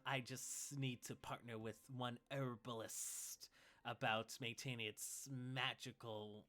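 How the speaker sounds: slow hissing snake-man shopkeeper; serpent accent. Acted. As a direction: stretch every s in a slow serpent voice. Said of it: "in the serpent accent".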